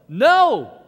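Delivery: loud, high shout. Retold or imitated. Husband yelling at the TV, a loud shout. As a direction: yell it out in the room. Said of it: "I find myself going".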